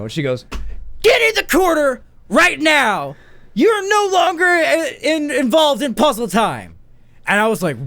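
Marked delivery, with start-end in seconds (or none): 1.0-6.7 much higher, slow, shrieking command; hammed-up furious order; teacher sends the child to the corner; mock stern teacher.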